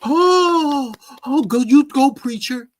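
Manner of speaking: high, loud mock cheer of the crowd; cheering congregation. Mocking the congregation cheering on the preacher, high and loud. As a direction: hype the preacher like an amen corner. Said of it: "And everybody".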